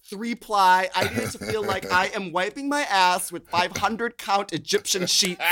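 normal talking voice

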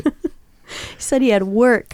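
slow, laughing through the words. Real, not acted. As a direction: laugh while you tell it. Laughing while retelling excuse.

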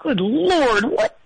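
muffled, face in a pillow. Real, not acted. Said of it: "I got my face down in a pillow".

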